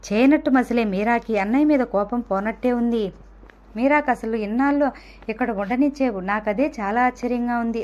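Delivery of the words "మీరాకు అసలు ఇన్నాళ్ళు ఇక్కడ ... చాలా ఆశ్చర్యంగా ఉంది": ordinary delivery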